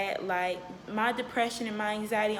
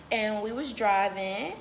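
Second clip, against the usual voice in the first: ordinary talk, nothing marked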